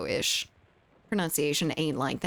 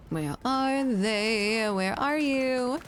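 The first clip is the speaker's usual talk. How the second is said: taunting sing-song; 5 semitones higher, much slower than usual. Pretend. Taunting hidden enemy in game.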